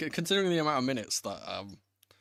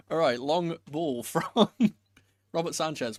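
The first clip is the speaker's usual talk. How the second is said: ordinary delivery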